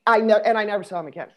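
ordinary talk, nothing marked

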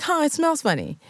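put-on high, turned-up nose kid; high, a touch of a grossed-out schoolkid; snooty kid voice. Kids mock her food.

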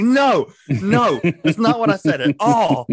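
loud, high-pitched protest; retold frantic denial. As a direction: blurt it out in panicked self-defence.

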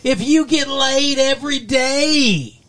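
high, loud, slow hammed-up setup; hammed-up comic voice for a parody one-liner; mock joke setup. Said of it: "you could do that with anything".

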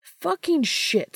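high loud slow curse; exasperated outburst. Acted. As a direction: blurt it out, exasperated. Dean's outburst.

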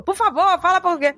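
high-pitched exasperated appeal; beg, as an imitated parent; mock pleading. Parent urging the child to speak Portuguese, mock pleading.

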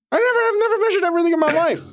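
high, fast disbelief at himself; rushed high confession; excited rush. Real, not acted.

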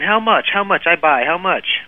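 high-pitched, pushy Russian buyer; mock Russian accent, repeated urgent demand; Russian mobster accent. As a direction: imitate an eager Russian haggler. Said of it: "He's got the accent going".

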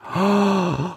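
big theatrical gasp; exaggerated intake of breath; mock gasp. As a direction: gasp in pretend shock. Mock shock at her own theory.

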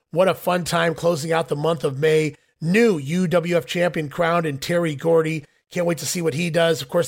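ordinary talk, nothing marked

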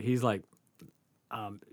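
usual speaking voice

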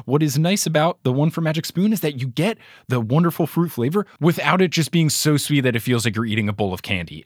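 ordinary talk, nothing marked

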